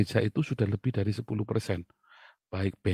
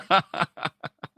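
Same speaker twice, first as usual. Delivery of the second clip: short high laugh; 6 semitones higher, 3 dB louder than usual. Real, not acted. Laughing after punchline.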